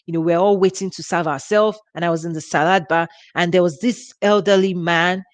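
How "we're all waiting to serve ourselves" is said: usual speaking voice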